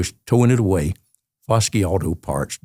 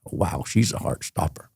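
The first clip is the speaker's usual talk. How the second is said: softer, lower admiring murmur under the breath; quiet aside; 4 semitones lower, 7 dB quieter than usual. Retold or imitated. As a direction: say it to yourself as she passes. Quiet aside about the girl.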